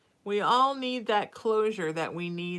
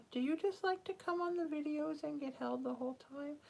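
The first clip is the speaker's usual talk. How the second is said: higher, softer cooing to a cat; pet talk; 7 semitones higher, 7 dB quieter, faster than usual. Real, not acted. Owner asking her cuddly cat, lifted soft coo. Speaking to cat she holds.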